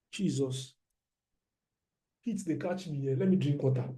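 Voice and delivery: muttered aside. Real, not acted.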